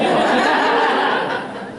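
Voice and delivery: breathy burst of laughter; real laugh. Real, not acted. Laughing after quoting a coworker, breathy laugh. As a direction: laugh off the joke.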